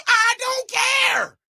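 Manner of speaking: high, slow, shouted I don't care; drawn-out defiant yell. Real, not acted. Defiant outburst at critics.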